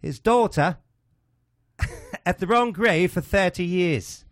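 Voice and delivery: a halting go at a posh English comic; buddy asks for a Cleese impression, slow high British voice; John Cleese. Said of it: "Now do more like John Cleese".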